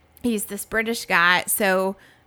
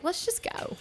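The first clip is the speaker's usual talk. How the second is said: whispered urge; 10 semitones higher, 10 dB quieter than usual. Retold or imitated. Quoting own impulse.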